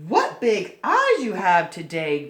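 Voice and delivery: put-on a curious girl noticing; little girl voice.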